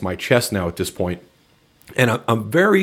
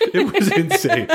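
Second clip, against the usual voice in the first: laughing hard. Real, not acted. Laughing harder, repeated.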